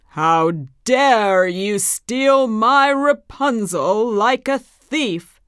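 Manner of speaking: loud, slow, furious witch voice; angry witch. A narrator's reading. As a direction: snarl it out like a wicked witch. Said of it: "said the witch angrily".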